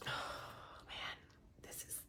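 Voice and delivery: breathy whisper while busy. Real, not acted. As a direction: whisper it under your breath. Whispering while wiping the mirror.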